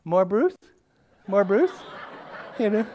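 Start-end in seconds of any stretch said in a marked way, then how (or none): 0.0-1.7 put-on high, loud little-kid question; Luke. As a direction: imitate a toddler asking, rising at the end. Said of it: "all I get from Luke is".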